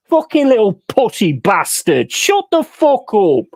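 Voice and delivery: ordinary delivery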